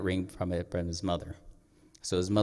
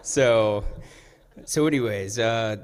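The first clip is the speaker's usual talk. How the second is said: a loud embarrassed laugh through the word; real sheepish laugh; 3 semitones higher, 9 dB louder, slower than usual. Real, not acted. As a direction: laugh away the emotion. Laughs off his tears.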